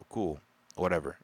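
ordinary talk

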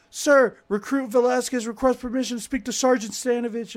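loud, high recruit shout; barked sir yes sir. Retold or imitated. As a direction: bark it at attention. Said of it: "I was like".

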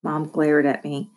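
ordinary delivery

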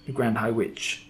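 ordinary delivery

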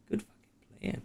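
hoarse whisper of good game; whispered praise. Pretend. Game master whispering praise to the players.

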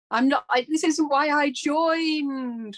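high, loud, slow exasperated wail; mock despair. Actor fed up with tech demands, high slow wail. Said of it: "And you go".

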